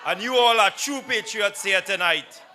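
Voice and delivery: formal, building speaker introduction; announcer introduction. Real, not acted.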